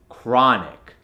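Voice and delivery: chronic slowed, with an open "ah"; American accent. Shown as a demonstration. Coach gives the converted word, American accent. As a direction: say "chronic" American, as "krah-nic". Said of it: "to the American accent".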